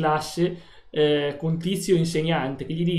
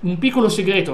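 loud hype; 7 dB louder than usual. Real, not acted. Hyping the audience about applause.

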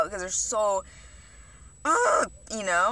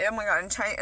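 ordinary delivery

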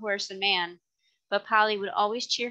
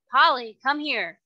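loud call for his wife to come; shouted call; 5 semitones higher, 5 dB louder than usual. A narrator's reading. Jerry shouts for Polly to come here. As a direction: raise the voice to summon her. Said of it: "he shouted".

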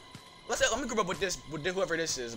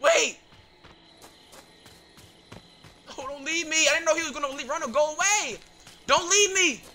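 higher, urgent begging not to be left; panicked pleading; 6 semitones higher than usual. Real, not acted. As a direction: call after a fleeing teammate in panic. Teammate leaves him in danger.

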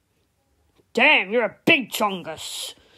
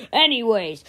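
ordinary delivery

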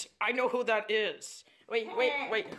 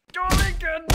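angry warning; 7 semitones higher, 8 dB louder than usual. Acted. Warns attacker after being hit.

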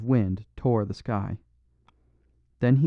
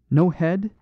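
sharp high, louder startled question; startled concern; 7 semitones higher, 5 dB louder than usual. A narrator's reading. Priest startled at the headless skeleton. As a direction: leap up in startled alarm. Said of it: "showed a leap of startled concern".

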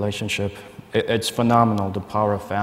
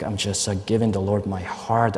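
ordinary talk

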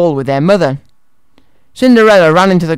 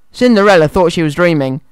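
ordinary delivery

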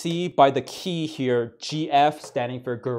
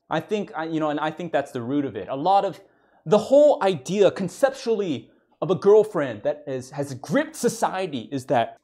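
normal talking voice

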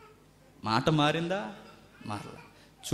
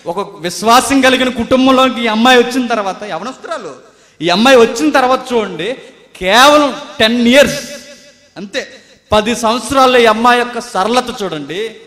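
loud, higher preaching voice; full-force preaching; 8 semitones higher, 13 dB louder than usual. Acted. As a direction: press it on the congregation.

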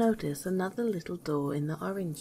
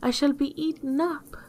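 higher-pitched storybook wail; a touch of storybook panic; frightened cry; 7 semitones higher, 4 dB louder than usual. A narrator's reading. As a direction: cry out as a frightened queen, mildly acted. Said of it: "she cried".

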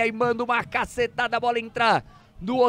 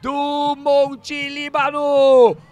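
screaming gol, high and very loud; explosive goal commentary; 7 semitones higher, 12 dB louder, much slower than usual. Real, not acted. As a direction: roar the goal, stretching the name. Second team scores, narrator screams.

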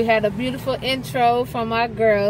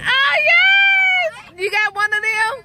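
very high, loud squeal of joy; excited holler; 6 dB louder, slower than usual. Real, not acted. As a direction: squeal with happiness. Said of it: "I'm sorry for the hollering".

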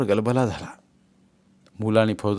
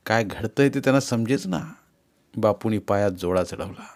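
ordinary delivery, nothing marked

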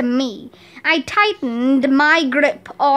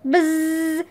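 drawn-out, monotone buzz; buzzer imitation; 3 semitones higher, 3 dB quieter than usual. Quiz contestant hitting the buzzer, imitated buzz.

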